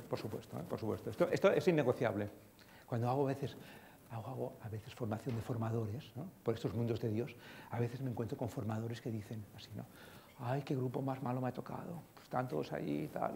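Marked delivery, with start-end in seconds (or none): 0.1-1.1 quiet emphasis. Shown as a demonstration. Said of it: "Motivarte tú". 10.4-13.3 weary trainer. Retold or imitated. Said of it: "Y me lo dice así, ¿no? Con esta pinta".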